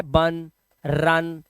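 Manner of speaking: फन, बर्न said very slowly; slow demo. Shown as a demonstration. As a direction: draw out फन and बर्न word by word. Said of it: "बड़े आराम से". (फन, बर्न को धीरे धीरे खींचकर)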